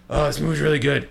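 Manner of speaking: put-on slurring, half-awake drunk voice; blackout drunk. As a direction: play it sleepy and sloshed. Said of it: "I'm really drunk right now".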